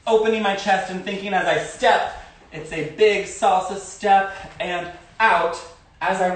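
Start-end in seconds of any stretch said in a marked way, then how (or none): none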